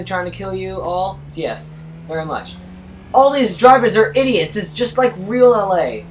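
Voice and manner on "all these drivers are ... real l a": loud, raised rant about bad drivers; loud exasperated rant. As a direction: vent at the idiots on the road. Ranting at drivers.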